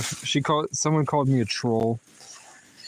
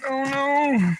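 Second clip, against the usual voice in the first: much higher, slow "oh no" wail; despairing whine; 11 semitones higher, much slower than usual. Real, not acted. Finding no fork in the bag, a high wail. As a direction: wail over the missing fork.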